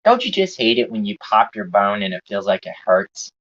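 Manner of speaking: usual speaking voice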